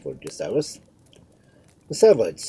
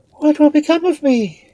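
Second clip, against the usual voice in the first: very high, loud queen's cry; high-pitched frantic lament; despairing wail; 13 dB louder than usual. A narrator's reading. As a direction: wail it in helpless alarm. Queen in despair wrings hands.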